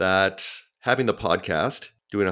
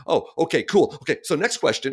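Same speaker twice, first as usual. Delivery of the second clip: high, breezy brush-off; a hammed-up careless podcast host; mock dismissive host; 7 semitones higher than usual. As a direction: toss it off like a bored interviewer. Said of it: "they go".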